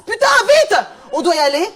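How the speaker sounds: high, loud hurry-up cry; acted urgency. Friend at a café terrace urging departure, urgent call. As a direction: call out loudly as if rushing off. Acts out hurried exit from terrace.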